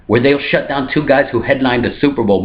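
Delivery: ordinary talk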